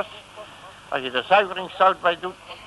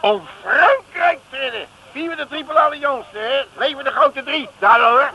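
high, loud rallying shout; proud patriotic cry; patriotic cheer; 11 semitones higher, 7 dB louder than usual. Acted.

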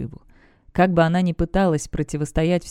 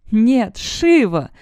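drawn-out despairing cry, higher and louder; desperate scream; 5 semitones higher, 5 dB louder, much slower than usual. A narrator's reading. Demoness screams after Shiva falling into the abyss, desperate. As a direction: cry out as a friend falls into the abyss. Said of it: "отчаянно закричала Демонесса".

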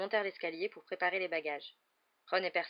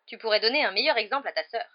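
louder, curt maternal rebuke; curt retort; 4 semitones higher, 10 dB louder than usual. A narrator's reading. Mother scolding her son about his example, curt. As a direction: cut him off with a sharp reproach. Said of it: "répliqua sèchement madame Weasley".

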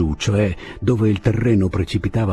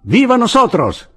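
high, loud rallying cry; exultant political slogan yelled high; shouted cheer; 12 semitones higher, 6 dB louder than usual. A narrator's reading. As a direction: hail the cause at full voice. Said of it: "gridò Andrés".

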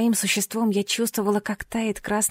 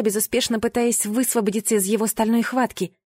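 usual speaking voice

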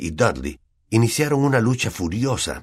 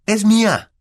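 put-on high, loud boy's protest; 7 dB louder, slower than usual. A narrator's reading. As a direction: cry out to claim what was snatched. Boy grabbing back his letter.

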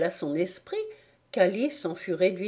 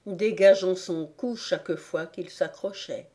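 ordinary delivery, nothing marked

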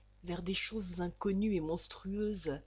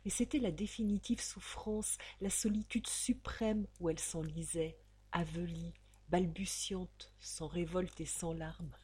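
plain reading voice

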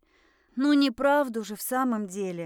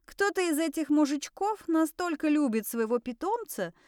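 ordinary delivery, nothing marked

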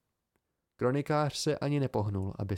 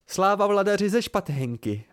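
higher, louder triumphant proclamation; mock-regal cry; 8 semitones higher, 5 dB louder than usual. A narrator's reading. Bast hails the newly crowned chronicler, crying out. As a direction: cry it out as a hail to a ruler. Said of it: "vykřikl".

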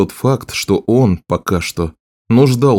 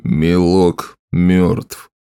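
very slow, lower-pitched voice; grim king; 4 semitones lower, much slower than usual. Acted. King tells the sorceress of a death, grim slow voice. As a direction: pronounce the death slowly and heavily.

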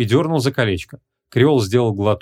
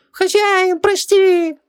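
very high, louder begging genie; pleading genie; 4 dB louder, much slower than usual. A narrator's reading. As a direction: beg for mercy in a squeaky voice. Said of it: "взмолился джин".

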